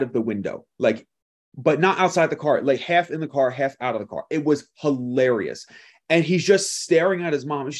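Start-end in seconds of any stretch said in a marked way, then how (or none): none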